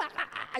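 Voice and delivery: put-on high, giggly baby cackle; mimicked infant cackle; imitated baby cackle. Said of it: "when they just cackle".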